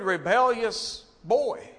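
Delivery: ordinary talk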